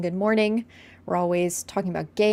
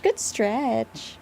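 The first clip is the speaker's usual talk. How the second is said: cooing pet owner; 2 semitones higher than usual. Retold or imitated. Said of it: "When she does anything".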